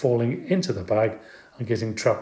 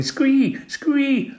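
very high squealing frog cry; screamed frog squee. A narrator's reading.

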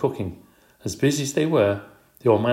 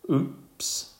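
breathless little oops; whispered oops; 5 semitones higher, 3 dB quieter than usual. A narrator's reading. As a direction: catch yourself in a whisper. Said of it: "I realised that Og had not".

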